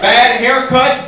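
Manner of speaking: ordinary talk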